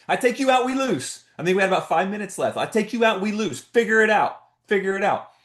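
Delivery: high-pitched coach's yell; hollering coach. Retold or imitated. Coach dad yells at his tired son to push on, high-pitched. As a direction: shout it across the court so he hears. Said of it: "I'm hollering at him on the court".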